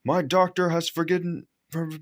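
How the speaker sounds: high, self-important stumble; pompous excuse. A narrator's reading. Fat controller excusing himself from pushing, pompous. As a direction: excuse yourself grandly, tripping on a word. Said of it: "he said".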